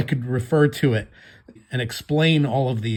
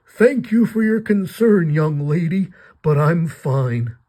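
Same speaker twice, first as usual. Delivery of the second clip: higher, slow, put-on old gentleman; slow and polite like a frail old man; 5 semitones higher, 4 dB louder, slower than usual. The old man politely declines Batsy's help, slow and frail. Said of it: "he says".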